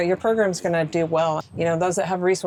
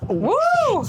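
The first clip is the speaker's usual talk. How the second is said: excited cheer; 3 dB louder than usual. Real, not acted. Cheering a pitch that came in hot.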